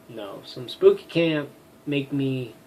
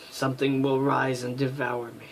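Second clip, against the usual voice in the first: ordinary delivery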